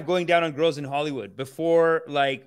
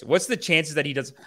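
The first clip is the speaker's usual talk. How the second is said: ordinary talk, nothing marked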